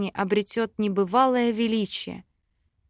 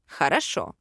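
agreeing fiancé; 7 semitones lower than usual. A narrator's reading. Fiancé consents to heroine's library plan, low calm voice.

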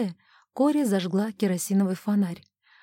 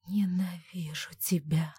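slow, low, bitter whisper; whispered hatred; 3 semitones lower, 8 dB quieter than usual. Acted. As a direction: whisper the hate slowly. Alone, hating the dying roses.

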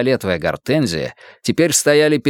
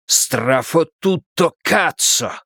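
angry curse; 4 semitones higher, slower than usual. A narrator's reading. Mario curses at betrayal.

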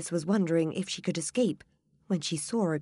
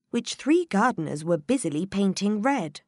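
plain reading voice

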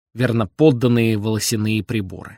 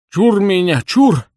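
operatic exclamation; 9 semitones higher, 6 dB louder, slower than usual. A narrator's reading. Old man wards off his dream, operatic loud cry. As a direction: exclaim it like a tragic opera singer. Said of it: "воскликнул он с шаляпинскими интонациями в голосе".

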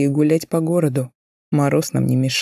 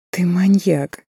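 giggling; 4 semitones higher, slower than usual. A narrator's reading. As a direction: giggle it out teasingly. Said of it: "хихикает она". (хихикая)

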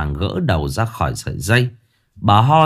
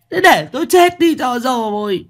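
wailing lament; 14 semitones higher than usual. A narrator's reading. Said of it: "khóc bù lu bù loa".

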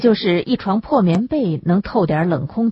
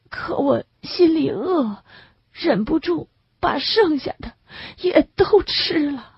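guilty confession; 9 semitones higher than usual. A narrator's reading. Patient confessing his overeating.

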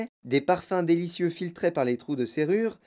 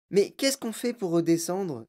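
put-on higher-pitched little boy's voice; Charlie asking; 4 semitones higher than usual. A narrator's reading. Little Charlie asking Wonka how to come down, questioning. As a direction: ask as a small boy, pitched up. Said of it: "demanda le petit Charlie".

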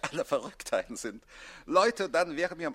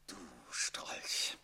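low hissing whisper; seething whispered insult; whispered fury; 12 semitones lower, 10 dB quieter than usual. Acted. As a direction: spit it quietly with contempt. Count seething at rival.

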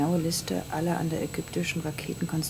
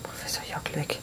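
whisper. Acted. Spotting someone on the plane.

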